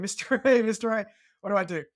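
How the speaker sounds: imitating a call across the rocks. Teen on the cliff calling to his mentor, mock urgent call. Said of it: "I kinda called out to him".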